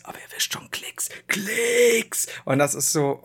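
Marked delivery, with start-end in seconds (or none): 0.0-1.1 conspiratorial whisper. Retold or imitated. Whispered aside in parody. 1.3-2.3 hyped YouTuber intro into a whisper; clickbait video narrator. Retold or imitated.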